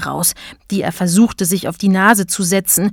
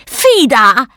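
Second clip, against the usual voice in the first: high, loud cartoon bellow; bellowing button policeman; 6 semitones higher, 5 dB louder, slower than usual. A narrator's reading. Button policeman orders Emma along, bellowing high. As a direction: bark orders in a high, stretched voice. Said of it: "brüllte der Polizist".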